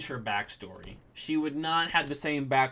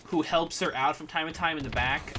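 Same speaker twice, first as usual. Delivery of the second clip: normal talking voice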